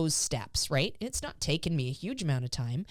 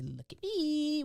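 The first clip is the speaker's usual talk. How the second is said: cue to dog; 11 semitones higher, slower than usual. Shown as a demonstration. Giving the cue without food.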